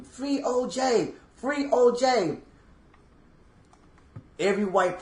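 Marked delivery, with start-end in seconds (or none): 0.0-2.4 high-pitched, drawn-out celebratory cry; mock screaming. Veteran re-enacting his unit running around screaming, mock high yell. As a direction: imitate a jubilant crowd yelling, voice pitched up.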